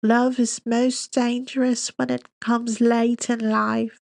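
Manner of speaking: slow, high mock-stuffy English accent; very slow affected English accent. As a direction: drag it out like a pompous English lecturer. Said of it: "she recites in a slow, stuffy English accent".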